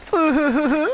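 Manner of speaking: short high embarrassed laugh; sheepish laugh. Acted. Jose laughs sheepishly after the cloud soaks him. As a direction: giggle it off.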